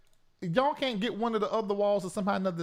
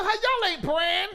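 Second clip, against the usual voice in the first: incredulous question; 9 semitones higher, 5 dB louder than usual. Retold or imitated. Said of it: "I said".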